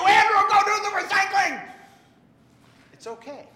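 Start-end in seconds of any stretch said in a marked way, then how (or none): 0.0-1.7 high, loud exasperated yell; mock yell at son. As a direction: bark the chore order like a fed-up parent. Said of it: "I lose my cool and I yell at you".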